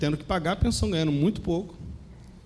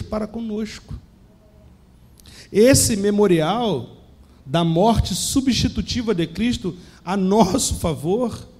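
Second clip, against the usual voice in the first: rising, higher-pitched sermon voice building in force; preaching; 5 semitones higher than usual. Acted. Pastor urging his church about the Lord's supper, preaching with rising fervour.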